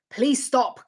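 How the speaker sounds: retold fed-up appeal, pushed up and out; exasperated plea. To a recent friend leaning on her, exasperated plea.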